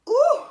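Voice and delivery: high, loud mock-shocked ooh; playful squeal. Real, not acted. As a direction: squeal it like a reaction shot. Reacting to her own warning.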